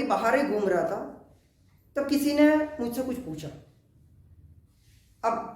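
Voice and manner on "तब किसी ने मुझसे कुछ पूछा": ordinary talk, nothing marked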